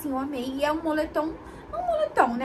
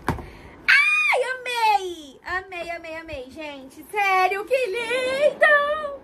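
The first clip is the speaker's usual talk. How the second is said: high squealing delight, half laughing; excited squeal; 6 semitones higher, much slower than usual. Real, not acted. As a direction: squeal with joy over a gift. Unboxing a gift, squealing with joy.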